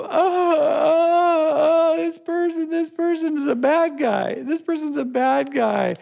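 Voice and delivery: high, loud, whiny wail of a self-pitying victim; mock victim whine. Mock victim whining about a person.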